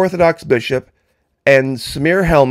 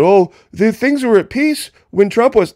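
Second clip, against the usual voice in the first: mock naive voice pitched much higher; mocking imitation; 8 semitones higher than usual. Imitating people who claim peace under Trump, high and mocking. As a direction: parrot them with a scoffing lilt. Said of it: "People said".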